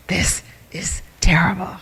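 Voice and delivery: hushed, unvoiced mock despair; whisper. Person dramatizing a ruined concert night, whispering. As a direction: breathe it out like a tragic secret. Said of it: "It's like".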